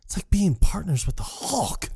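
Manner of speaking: high-pitched fed-up complaint; retold exasperation. Acted quarrel between partners.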